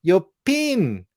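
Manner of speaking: high, loud New Zealand "pin"; thick New Zealand accent. Retold or imitated. Showing how the New Zealand girl said it, thick accent. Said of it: "like that".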